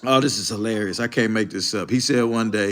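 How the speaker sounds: ordinary delivery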